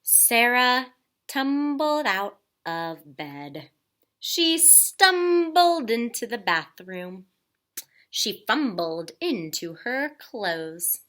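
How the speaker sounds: slow, emphatic storytelling; dramatic narration. A narrator's reading. Narrator describing sleepy stumbling, slow and emphatic. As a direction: draw out each clumsy step.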